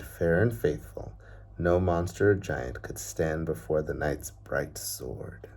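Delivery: even, unhurried reading-aloud voice; storybook narration. Acted. Teacher reading a picture book to children, story narration. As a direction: tell the tale like a bedtime story.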